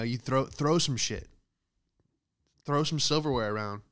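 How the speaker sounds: ordinary delivery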